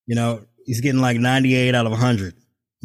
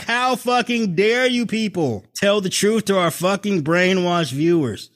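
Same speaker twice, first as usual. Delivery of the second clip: mock Murdoch rant; 7 semitones higher than usual. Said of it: "Rupert Murdoch is like".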